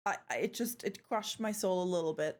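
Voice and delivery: usual speaking voice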